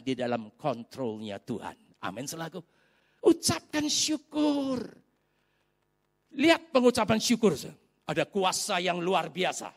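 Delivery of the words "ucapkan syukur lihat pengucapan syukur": high, long-drawn call; impassioned exhortation. Real, not acted. Preacher urging congregation to give thanks, high drawn-out call. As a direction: stretch it out as a ringing refrain.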